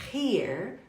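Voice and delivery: "here" with a strong breathy H; H pronounced. Shown as a demonstration. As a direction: sound the H at the start of "here". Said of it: "você viu um H".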